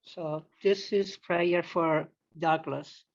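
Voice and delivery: ordinary talk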